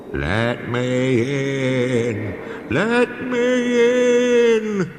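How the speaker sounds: very high, very slow, sung ghost wail; spooky voice. Acted. Percy pretends to be a ghost, spooky wail. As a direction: moan it like a ghost at the door. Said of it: "said Percy in a spooky voice".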